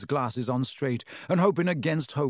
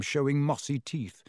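ordinary delivery

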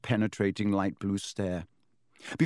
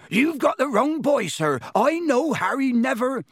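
high, agitated pleading; ranting Hagrid; 14 semitones higher, 5 dB louder than usual. A narrator's reading. Hagrid pleading with Dumbledore, agitated rant. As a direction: rant on, no room for interruption. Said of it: "Hagrid went ranting on".

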